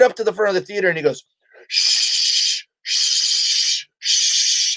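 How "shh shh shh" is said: long, hard repeated shushes; shushing. Retold or imitated. Waiter shushing the comic onstage, drawn-out shushes. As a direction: shush someone urgently, three times. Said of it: "He's, like, shushing me".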